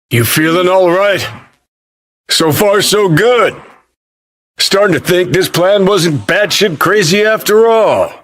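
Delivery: high, projected shout to a partner. Acted. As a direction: call over the noise of action. Shouted exchange during action.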